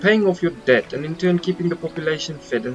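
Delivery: pleased, polished posh English host; posh English mayor. Acted. Mayor greets the returning fisherman in a light posh English voice. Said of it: "Oh, the, the posh Englishman".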